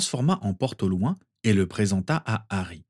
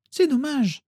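put-on much higher child's voice; little girl Rose; slower than usual. A narrator's reading. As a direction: play the little sister, very high. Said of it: "fit remarquer Rose". (petite Rose, voix d'enfant très aiguë)

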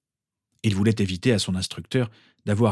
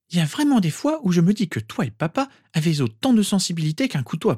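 high-pitched, fed-up scolding; exasperated reproach; 9 semitones higher than usual. A narrator's reading. Reproaching him and papa for insensitivity, exasperated. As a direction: complain in a raised pitch, exasperated. Speaker berates listener's insensitivity.

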